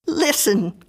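crying out. A narrator's reading. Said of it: "she cried".

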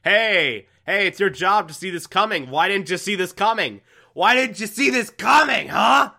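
hammed-up freak-out building to a yell; mock freak-out. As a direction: build from a snap to a full mock shout. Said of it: "great little freak out".

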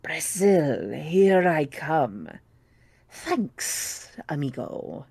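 low, slow snake hiss. Acted. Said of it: "a low, hissing noise said".

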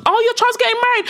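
high, gushing party-guest voice; mock gushing guest. Guests fussing over mum at the wedding, high mock gush. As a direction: chirp it like a nosy wedding guest.

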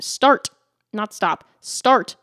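emphatic, separated "Start"; stress on Start. Retold or imitated. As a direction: hit "Start" hard both times, setting it against "stop". Correcting stop to start for emphasis.

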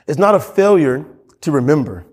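usual speaking voice

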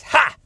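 loud, high single gloating laugh; triumphant "ha". Acted. Angel after injuring Yaakov's hip, gloating. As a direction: burst out one sharp, high "ha".

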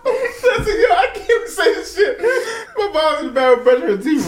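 high, breathless laughing through tears; laughing tearfully. Real, not acted. Retelling his mom story to friends, crying with laughter. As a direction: push the words out between laughs.

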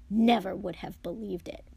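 ordinary delivery, nothing marked

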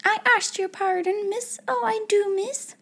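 high, flustered little maid; high-pitched timid pleading; frightened stutter. A narrator's reading. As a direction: beg pardon in a high, anxious voice. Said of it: "she stuttered".

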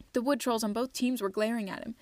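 plain reading voice

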